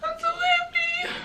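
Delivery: very high, soft, drawn-out squeak; happy squeal. Real, not acted. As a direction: squeal it slowly in tiny-voiced delight. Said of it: "I'm happy about it".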